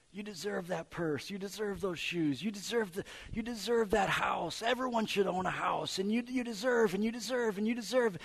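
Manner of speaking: building into a high, eager sales pitch; a flatterer working himself up; flattering tempter. Retold or imitated. Culture voiced as a flatterer.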